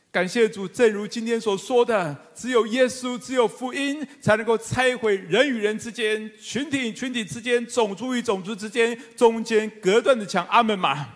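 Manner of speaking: high, loud, ringing sermon proclamation; fervent pulpit climax, every phrase pushed up; preaching at full force. Acted. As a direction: proclaim it to the whole congregation.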